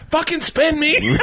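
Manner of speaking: put-on high, slow pleading kid voice; kid-like demand. Bobby on the tube begging to be spun, kid-like whine. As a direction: drag it out like a bored kid. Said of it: "he's like".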